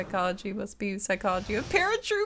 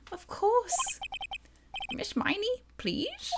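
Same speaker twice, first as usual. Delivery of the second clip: high, soft coaxing plea; high judge voice; 12 semitones higher, 5 dB quieter, slower than usual. Acted. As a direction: gently coax the witness. Judge pleads softly.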